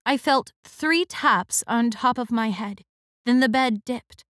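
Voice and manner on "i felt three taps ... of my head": ordinary delivery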